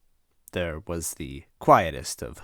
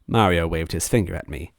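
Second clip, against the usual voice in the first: plain reading voice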